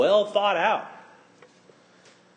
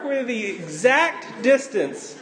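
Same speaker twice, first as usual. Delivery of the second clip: usual speaking voice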